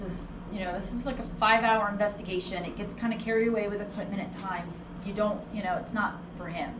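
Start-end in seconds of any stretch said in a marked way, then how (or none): none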